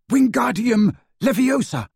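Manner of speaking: high, loud spell-casting cry; forceful high incantation. A narrator's reading.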